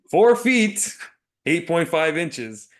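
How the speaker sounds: high, loud triumphant reveal; emphatic punchline. Real, not acted. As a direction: announce the answer with a big punch. Triumphant punchline after laughter.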